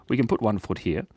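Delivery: normal talking voice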